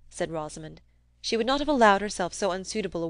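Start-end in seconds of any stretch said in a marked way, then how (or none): none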